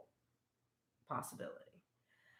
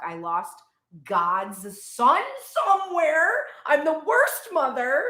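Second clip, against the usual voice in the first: much higher and louder, hammed-up panic; mock panic; 7 semitones higher, 12 dB louder, slower than usual. Mary realizing she lost Jesus, in hammed-up panic. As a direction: wail it like a frantic mother.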